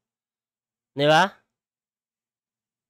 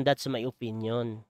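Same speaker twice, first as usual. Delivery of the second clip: softer, slow mocking echo of the senator; mocking quote, softer; 8 semitones lower, 10 dB quieter than usual. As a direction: repeat it softly and slowly, half-teasing. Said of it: "sabi ni Idol".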